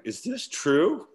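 high, slow, drawn-out disbelief; can't believe the friend is GM; mock disbelief. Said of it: "I was like".

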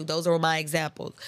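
ordinary delivery

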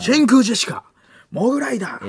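high-pitched announcer roll call; hammed-up show announcement; mock finalist announcer. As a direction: call each act like a TV announcer.

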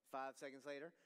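ordinary talk, nothing marked

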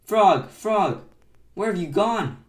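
loud, high cries for a friend; desperate calling. A narrator's reading. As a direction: call out in distress. Toad calling for lost Frog.

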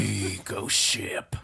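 slow eerie whisper for a ghost story title; hushed, slow creepy hush; spooky whisper. Acted. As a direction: breathe it out slowly like a ghost tale.

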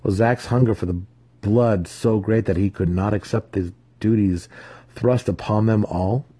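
usual speaking voice

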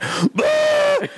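high, wailing Wookiee cry; Chewbacca roar. Retold or imitated. Chewbacca grieving in the movie, high howl. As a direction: let out a mock Chewbacca howl. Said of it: "Chewbacca went".

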